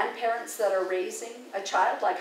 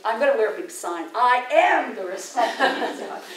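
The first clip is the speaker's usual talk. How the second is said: laughing through the words; 2 semitones higher, 5 dB louder than usual. Real, not acted. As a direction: laugh at the irony of it. Laughing at the irony.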